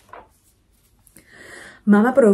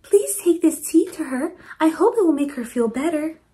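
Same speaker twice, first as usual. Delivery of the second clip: Missus Wu voice; 7 semitones higher than usual. Acted. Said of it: "said Missus Wu".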